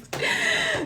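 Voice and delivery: high, short burst of laughter; quick high laugh at the mockery. Real, not acted. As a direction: laugh out at the joke. Laughs at own impression.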